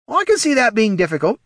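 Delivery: high, loud character voice; ghoul's remark. Acted. Ned answers the bodiless shade, high ghoul voice. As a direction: put in a comment, pitched high.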